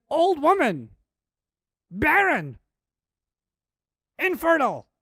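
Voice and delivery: loud, high shouted outburst; imitated scream. Preacher acting out Ibrahim's shocked wife, screaming words. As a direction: cry out each word separately, high and loud. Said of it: "she screams these words".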